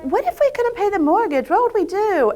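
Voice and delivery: high-pitched mock fretting; worried husband. Husband worrying about the mortgage, mock fretting. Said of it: "he'd be like".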